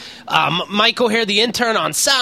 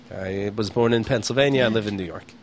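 low, softer deadpan reply; straight-faced; 10 semitones lower, 5 dB quieter than usual. Retold or imitated. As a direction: retell a dry, patient correction. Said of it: "do I have to have a straight face".

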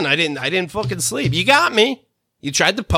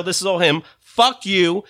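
ordinary talk

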